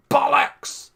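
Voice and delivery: high, loud mimicked exclamation; imitated shout. Streamer echoing the clip's bollocks, mimicked shout. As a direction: shout it like a catchphrase.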